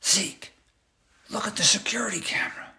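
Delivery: quick, urgent whisper; a fast hushed warning. A narrator's reading. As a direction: whisper it to the friend across the aisle. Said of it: "whispered across the aisle".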